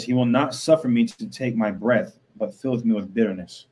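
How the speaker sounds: loud, declaiming Bible verses to the room; scripture reading. Acted. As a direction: proclaim each verse like from a pulpit. Reads scripture aloud.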